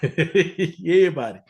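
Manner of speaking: laughing through a put-on Southern accent; Southern accent, half-laughing; fake Southern accent. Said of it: "That's my fake Southern accent".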